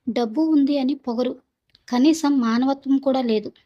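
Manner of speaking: usual speaking voice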